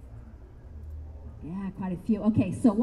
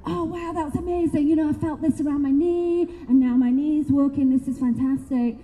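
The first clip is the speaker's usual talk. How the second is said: gushing client; 5 semitones higher than usual. Retold or imitated. Clients praising the Reiki.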